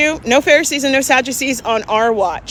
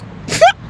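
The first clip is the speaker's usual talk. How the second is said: laugh. Real, not acted. Laughing at her retort.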